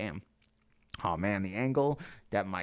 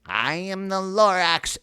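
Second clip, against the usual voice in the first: Lorax voice; 12 semitones higher, 7 dB louder than usual. Acted. Lorax introduces himself.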